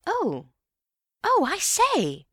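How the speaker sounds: Bill catching on, excited high exclamation. A narrator's reading. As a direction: gasp it out as it dawns. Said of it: "Bill was rather excited again".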